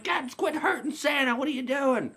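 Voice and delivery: put-on very high child's plea; chubby kid stepping in to defend Santa; small kid voice.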